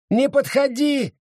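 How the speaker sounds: high cry to stay back; shouting. Acted. Sara shouts at Abita not to come closer. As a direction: cry out to keep her away. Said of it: "крикнула Сара".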